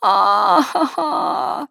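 high, drawn-out wail of dread; dismayed groan. Acted. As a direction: let out a long moan of dismay. Bella dreads the dress fitting.

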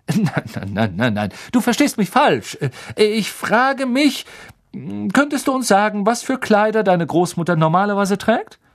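a friendly professor coaxing a shy pupil; Professor Lupin voice. Acted.

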